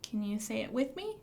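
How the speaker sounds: normal talking voice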